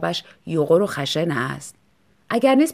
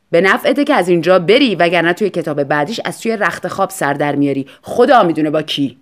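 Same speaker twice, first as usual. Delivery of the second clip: husband's warning; 2 semitones lower, 7 dB louder than usual. Acted. Husband warns salesman.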